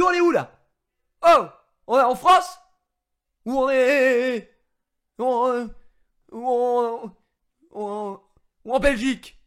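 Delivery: loud, high mock-outraged shouting; mock indignant. Telling the agent about the bailiff, hammed-up outraged shout. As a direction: play the fed-up guy blowing up. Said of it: "je fais le gars un peu vénère".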